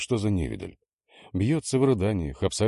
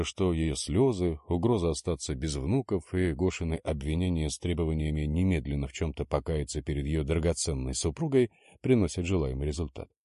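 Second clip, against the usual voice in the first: ordinary delivery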